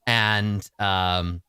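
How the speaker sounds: usual speaking voice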